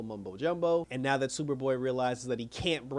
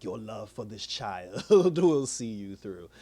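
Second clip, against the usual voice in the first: slow, lower mystic voice breaking into a laugh; old mystic woman; 4 semitones lower, slower than usual. Retold or imitated. Crazy old lady reassuring Dick before the spell, mystical. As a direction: intone it slowly like a hammed-up seer.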